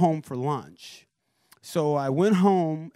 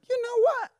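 much higher, eager burst; sudden resolve. Retold or imitated. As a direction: seize the moment. Sudden decision to call.

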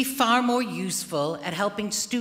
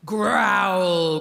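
loud crying growl from a mother bear; mama bear growl; 4 semitones higher, 7 dB louder than usual. A narrator's reading. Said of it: "growled the mama bear".

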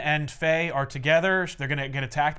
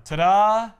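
higher, louder presenting flourish; showy ta-da; 5 semitones higher, 6 dB louder than usual. Retold or imitated. Iron Man delivering the kids, showy ta-da. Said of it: "and he says".

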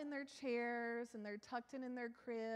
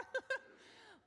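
laugh; 13 semitones higher than usual. Real, not acted. Laughing at her own outburst.

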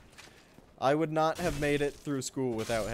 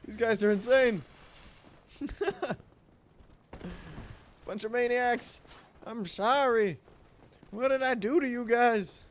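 laughing outrage; 9 semitones higher than usual. Real, not acted. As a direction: yell at them in disbelief. Still reacting to the enemies.

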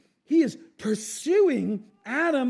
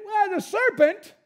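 high, loud excuse; mock blame-shifting; 10 semitones higher, 5 dB louder than usual. Eve answers God by blaming the serpent, high mock protest.

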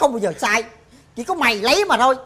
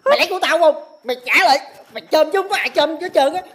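angry accusation; 6 semitones higher, faster than usual. Acted. Brother accusing Tám of stealing money.

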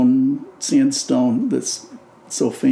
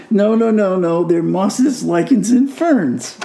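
insistent correction; 6 semitones higher, 3 dB louder than usual. Retold or imitated. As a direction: correct a kid firmly in a retold exchange. Retold exasperated correction of the kid.

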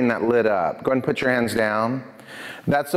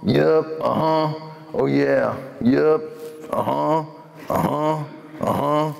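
put-on drunk cowboy; much slower than usual. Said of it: "This cowboy dude goes like this".

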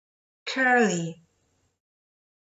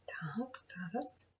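whisper; 16 dB quieter, slower than usual. Real, not acted. Teacher thinking aloud.

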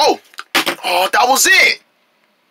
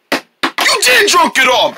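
angry; 9 semitones higher than usual. Acted. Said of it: "you're getting mad".

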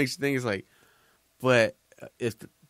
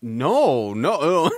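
louder, rising no no; flustered denial; 4 semitones higher, 8 dB louder than usual. Retold or imitated. Nerd denying he wants her drunk, loud flustered protest. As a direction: blurt it out, caught red-handed. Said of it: "And he's all".

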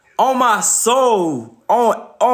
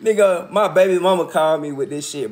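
ordinary talk

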